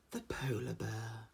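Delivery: soft, slow mock nature presenter; hushed wildlife-film voice, lightly done; documentary narrator. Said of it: "the one there he goes".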